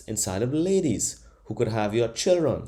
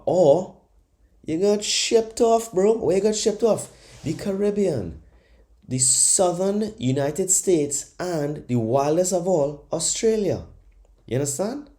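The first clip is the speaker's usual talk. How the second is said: heated; 7 semitones higher than usual. Real, not acted. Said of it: "I'm getting heated".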